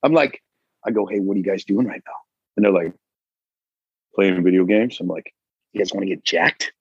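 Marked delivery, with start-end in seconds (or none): 1.1-6.7 low-key sales pitch. Acted. Acting out pitch to his kids.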